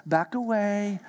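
soft, careful hush backing away; cautious hush. Shown as a demonstration. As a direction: coax the lion gently and softly. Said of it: "you do things like this".

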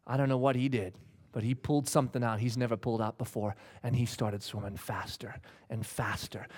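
low, soft storytelling; hushed suspense. Real, not acted. Preacher telling of the swimmer's comeback, hushed suspense. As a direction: drop right down to build suspense.

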